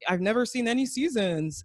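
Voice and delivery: put-on cluelessness; playing dumb. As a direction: lightly imitate someone feigning ignorance. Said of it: "playing up the".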